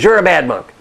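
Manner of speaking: loud, high mock-furious accusation; acted indignation at full volume; angry outburst. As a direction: burst out like an outraged monk. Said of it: "his colleague is angry".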